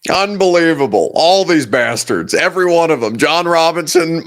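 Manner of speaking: loud, emphatic exasperation; loud, punchy indignant rant; indignant outburst. Real, not acted. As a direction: rail loudly at the lot of them. Mock outburst after played clip.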